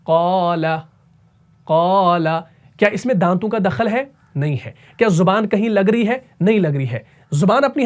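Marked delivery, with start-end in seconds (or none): 0.1-2.4 क़ाला with a heavy क़ and the aa ringing in the mouth; tajweed demo. Shown as a demonstration. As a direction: stretch the aa of क़ाला from the mouth cavity. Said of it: "ये आवाज़ कहाँ से आ रही है".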